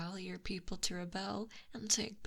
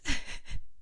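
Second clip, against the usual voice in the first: mocking whispered laugh; 6 dB louder than usual. Mocking the captive prince.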